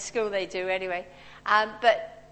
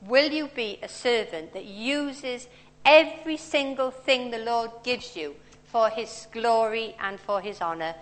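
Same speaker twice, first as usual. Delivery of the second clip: ordinary talk, nothing marked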